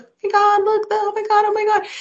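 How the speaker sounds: high excited gasps of strangers; gasping crowd. Retold or imitated. Bystanders seeing the proposal, high excited gasps. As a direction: squeal it like a thrilled onlooker. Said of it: "And they're like".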